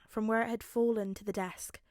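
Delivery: ordinary delivery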